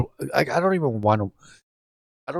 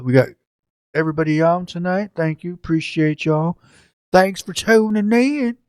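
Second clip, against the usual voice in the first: bad Southern accent; 8 semitones higher, 3 dB louder than usual. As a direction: thank them in an exaggerated Southern twang. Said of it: "probably the worst Southern accent in the history".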